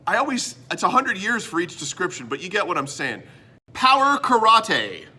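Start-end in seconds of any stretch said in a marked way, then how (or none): 3.7-5.1 "karate" ending in a long "tay", called out loud; showy karate pronunciation. Shown as a demonstration. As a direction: draw out "karate" as ka-ra-TAY, higher and slow. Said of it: "I like saying it like that".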